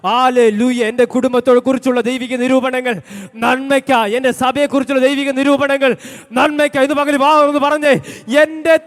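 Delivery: preacher crying out the closing promise; fervent preaching. Real, not acted. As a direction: lead the declaration to its peak. Preaching at full force.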